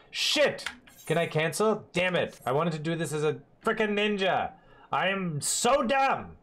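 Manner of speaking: high, exasperated cursing; frustrated outburst. Real, not acted. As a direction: curse at your own mistake. Mistake in game.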